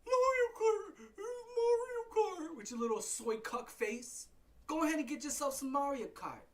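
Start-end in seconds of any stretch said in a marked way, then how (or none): 0.1-2.6 high, strained mock squeal; mock excited fan. Mocking Nintendo fans.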